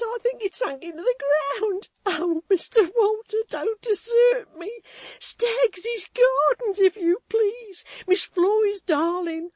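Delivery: high, loud, crying desperate plea; frantic tearful pleading. A narrator's reading. Maid begging Walter to help find the nurse, crying. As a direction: beg through sobs, barely holding together.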